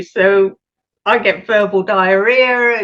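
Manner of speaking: ordinary delivery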